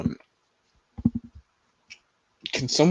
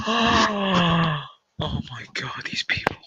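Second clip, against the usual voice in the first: stunned whisper; 8 semitones higher, 4 dB quieter, slower than usual. Real, not acted. Shock after the gasp.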